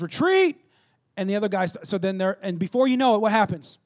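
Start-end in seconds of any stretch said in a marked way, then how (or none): 0.0-0.6 high, loud cry of retreat; panicked yell. Retold or imitated. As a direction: yell it high as the soldiers flee. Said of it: "And one guy yells".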